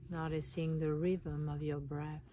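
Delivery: calm meditation voice with long pauses; guided meditation. Acted. Guiding listeners to relax and notice their breath, meditation voice. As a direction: guide them inward, unhurried, leaving space between phrases.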